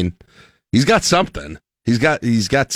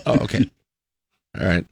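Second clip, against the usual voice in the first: ordinary talk, nothing marked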